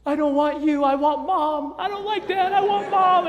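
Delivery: put-on high, loud wailing small-child voice; crying child tantrum. Five-year-old rejecting dad and wanting mom, mock crying tantrum. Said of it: "He starts crying".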